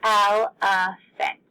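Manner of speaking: "Elephant" in three slow, separated beats; slow, syllable-by-syllable "Elephant"; broken into syllables. Shown as a demonstration. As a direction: clap out "Elephant" as el-e-phant. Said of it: "That is how many syllables it has".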